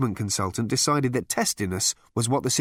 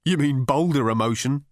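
ordinary delivery, nothing marked

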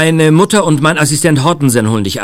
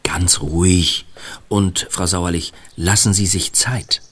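slow, hushed reassurance; soothing whisper; 6 semitones lower, 6 dB quieter, slower than usual. Acted. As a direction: whisper slowly to calm her. Calming mother before the call. (langsam beruhigend flüsternd)

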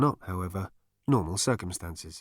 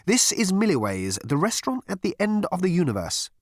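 raised, exaggeratedly patient announcement; laid-on patience; 9 semitones higher than usual. A narrator's reading. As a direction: spell it out with heavy patience, pitch lifted. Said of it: "laying on the patients with a trowel".

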